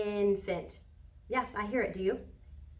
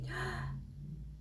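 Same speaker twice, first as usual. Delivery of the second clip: delighted gasp; 8 semitones higher, 10 dB quieter than usual. Acted. Teacher reacts to favorite picture.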